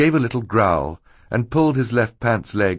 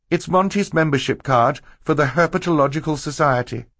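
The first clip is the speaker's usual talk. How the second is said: put-on higher, earnest girl character voice; higher-pitched explaining voice for Violet; 9 semitones higher, 4 dB louder than usual. A narrator's reading. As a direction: lay out the villain's plot, pitched up. Narrator voices Violet explaining the plot.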